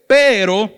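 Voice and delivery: loud "Pero" announcing the turn; emphatic contrast. Shown as a demonstration. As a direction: lean hard on "Pero". Vuelve al pero del contraste. ("Pero" fuerte y recalcado)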